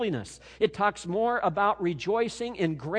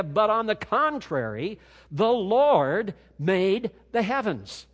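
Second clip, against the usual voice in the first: louder and slower, driving "But" and "the Lord made the heavens" home; emphatic; 4 semitones higher, 5 dB louder, slower than usual. Shown as a demonstration. Pastor giving the original's force to listeners, emphatic. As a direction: proclaim the contrast with weight. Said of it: "here it's emphatic in the original language".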